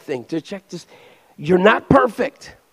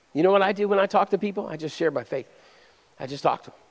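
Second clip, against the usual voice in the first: normal talking voice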